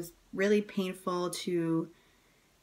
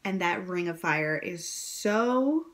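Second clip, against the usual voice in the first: normal talking voice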